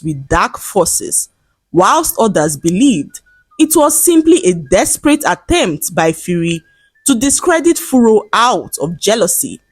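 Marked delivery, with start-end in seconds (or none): none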